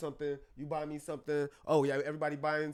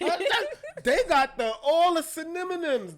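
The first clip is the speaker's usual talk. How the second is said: higher and louder through laughter; laughing; 12 semitones higher, 9 dB louder, slower than usual. Real, not acted. Amused therapist laughing about how women name feelings.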